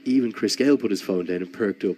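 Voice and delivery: usual speaking voice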